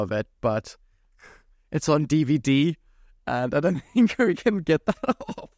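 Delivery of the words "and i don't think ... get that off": higher-pitched, laughing through the words. Real, not acted. Admitting the video is stuck on DVD, laughing. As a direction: let real laughter break up the sentence.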